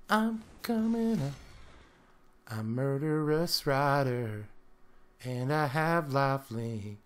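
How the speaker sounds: slow put-on menacing rider; card character voice. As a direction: speak as the creature itself. Voicing the card creature.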